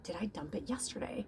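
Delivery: hushed worried whisper to herself; whispered thought. Retold or imitated. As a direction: whisper it like a private doubt. Retelling her own panicked thought.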